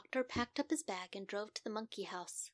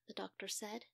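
ordinary delivery

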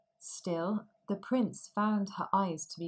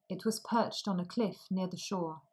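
ordinary delivery, nothing marked

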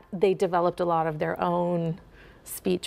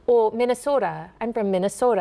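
Minnesota accent; 2 semitones higher, faster than usual. Shown as a demonstration. Dialect coach showing how locals name their state, Minnesota accent. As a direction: lean on the long Minnesotan O. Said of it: "without doing the Minnesota O".

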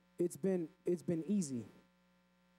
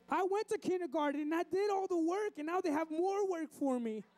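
high, wailing mock child; crying kid; 12 semitones higher than usual. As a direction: protest like a tearful first grader, hammed up. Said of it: "and he's crying".

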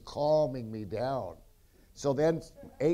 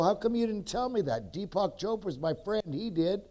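high-pitched retold complaint; indignant protest; 8 semitones higher than usual. Man retelling his complaint that nobody told him, indignant protest. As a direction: protest in a raised, aggrieved pitch.

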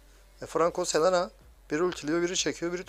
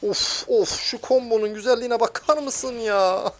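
thrilled; 8 semitones higher, 7 dB louder, slower than usual. Real, not acted. Admiring own combo, thrilled.

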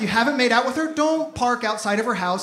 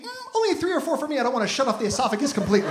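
mock diner; 3 dB quieter, faster than usual. Imagined diner's thought voiced.

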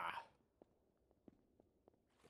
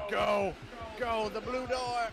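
high urgent yell; shouting; 12 semitones higher, 10 dB louder, slower than usual. Real, not acted. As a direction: yell directions in panic. Urging partner during chase.

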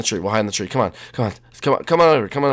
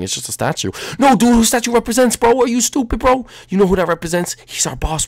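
ordinary talk

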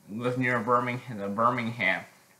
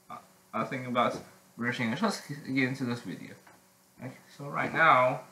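ordinary talk

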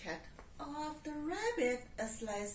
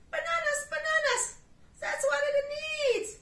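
much higher, loud excited monkey; monkey chuckling; 10 dB louder than usual. Acted. Monkey telling the cat the cake needs bananas. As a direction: squeal it like a giddy monkey. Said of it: "chuckled the monkey".

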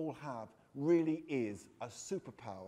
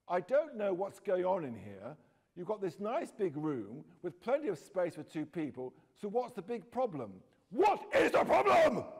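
exploding; 3 semitones higher, 7 dB louder than usual. Acted. Stepdad Roy scolding the boys, building to an explosion. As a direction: let it boil over at the end. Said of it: "Roy exploded beside her".

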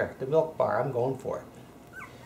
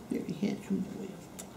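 whisper; 5 semitones higher, 9 dB quieter than usual. Real, not acted. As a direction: whisper the praise close to the pet. Praising pet, whispered.

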